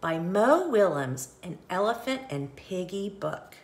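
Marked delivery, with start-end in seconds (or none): none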